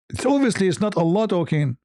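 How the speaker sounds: ordinary delivery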